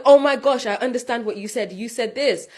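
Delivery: excited. Retold or imitated. Retelling excitement at understanding.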